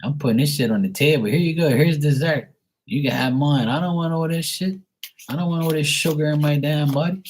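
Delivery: mock ranting. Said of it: "And I'm like".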